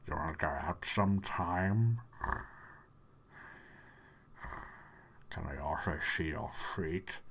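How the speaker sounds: low, soft creep with heavy breaths; creepy voice. Shown as a demonstration. Creep asking someone out, in a low, creepy voice. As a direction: leer it low, with long breathy pauses. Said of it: "that's creepy to someone else".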